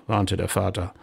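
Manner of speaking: ordinary delivery, nothing marked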